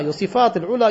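ordinary delivery

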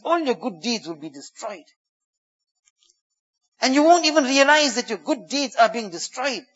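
ordinary talk, nothing marked

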